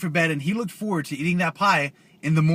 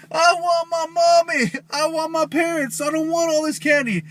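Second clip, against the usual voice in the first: very high, hammed-up mock wailing; crying boogeyman; 10 semitones higher than usual. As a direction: bawl like a big baby, pitched way up. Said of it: "starts crying".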